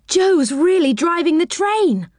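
put-on high, thrilled girl's voice; excited cry. A narrator's reading. Beth thrilled watching Joe drive, high cry. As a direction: she can hardly believe it. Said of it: "cried Beth".

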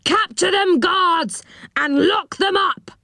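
high-pitched, shouted-style order to guards; urgent command. A narrator's reading. Enchanter orders his elves to seize intruders, commanding. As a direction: send the guards after them, high and urgent.

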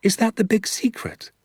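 put-on high, incredulous boy's question; surprised child. Danny doubting his father's big secret, high and incredulous. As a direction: sound like a disbelieving young boy.

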